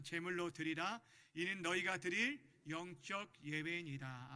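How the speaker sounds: measured, projected Bible recitation; even, deliberate group-reading voice; leading scripture reading. Acted. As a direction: read it out firmly for everyone to join. Leads congregational scripture reading.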